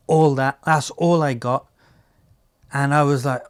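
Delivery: normal talking voice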